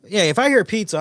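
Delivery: high, loud burst of excitement; eager shout about pizza; excited. Retold or imitated. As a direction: jump at the word pizza.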